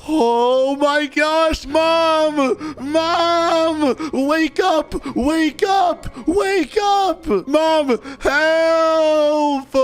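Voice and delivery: mock scared child shouting for mom; pretend calling for mom. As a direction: yell for mom to wake up, scared. Child calling mom after ghost.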